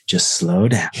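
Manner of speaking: calm coaching voice. Retold or imitated. Coaching a rushing wrestler.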